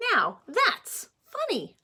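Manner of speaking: slow, high, drawn out punchline. Acted. Pete admiring his bunny ears, slow and amused. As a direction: land the rhyme with a grin.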